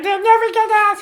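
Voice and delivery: ordinary delivery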